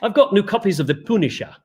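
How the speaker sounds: imitating a Scottish shopkeeper; Scottish accent. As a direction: keep up the mock Scottish accent. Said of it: "And he would say".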